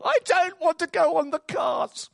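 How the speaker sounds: put-on high comic old man's complaint. Old man refusing the cart, high complaining voice. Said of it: "this old man says".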